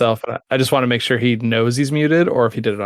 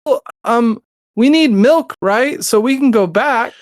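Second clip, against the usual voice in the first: put-on higher, a scheming little kid angling for another trip; 9 semitones higher, slower than usual. Son begging dad to return to the store, higher kid voice. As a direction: voice the son, hopeful and sneaky. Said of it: "And he's like".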